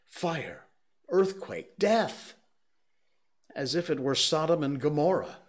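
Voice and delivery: rising panic. A narrator's reading. Curate reliving the disaster, building to a cry. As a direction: climb in panic word by word.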